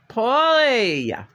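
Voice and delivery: loud, high drawn-out call; excited call. Real, not acted. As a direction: sing out the name, high then falling. Calling out to Paulie.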